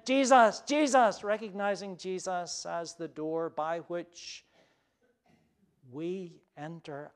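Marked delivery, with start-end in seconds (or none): 0.0-1.2 higher and louder urgent plea; pleading call. Retold or imitated. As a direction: pound on the door with your voice. Thief pounding on the door.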